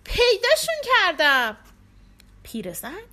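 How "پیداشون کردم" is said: high, loud excited shout; triumphant shout, much higher; cry of discovery. A narrator's reading. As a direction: yell it out as you spot them. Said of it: "فریاد زد".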